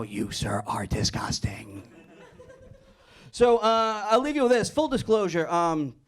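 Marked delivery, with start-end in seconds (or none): 0.0-1.8 low, slow, almost whispered disgust; slow hushed mock-anchor insult. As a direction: lean in and whisper your disgust.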